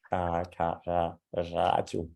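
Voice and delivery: "ah, ka, ra, radio" with the R from deep in the throat; Danish R drill. Shown as a demonstration. Teacher drilling "ah, ka, ra, radio" with a Danish R. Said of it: "I will usually say".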